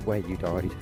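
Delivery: normal talking voice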